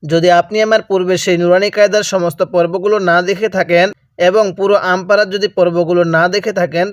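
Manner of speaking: ordinary delivery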